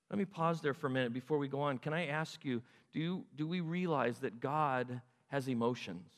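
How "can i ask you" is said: ordinary delivery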